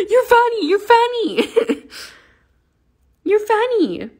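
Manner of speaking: delight in the kitten with a coo. Real, not acted. Owner cooing at her kitten in high baby talk.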